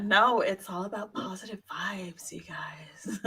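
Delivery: whispered, hushed reassurance; mock whisper. Host hushes positive vibes to the group, mock whisper. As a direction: whisper it as a playful mantra.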